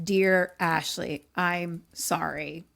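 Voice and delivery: drawn-out, grudging read-aloud apology; insincere apology. Acted. Boy forced to write an apology note, insincere. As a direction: read the apology slowly, without meaning it. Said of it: "a little more sincere this time".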